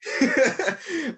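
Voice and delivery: sudden high laugh; high burst of laughter. Real, not acted. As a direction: laugh out at the joke. Laughing at his own bad accent.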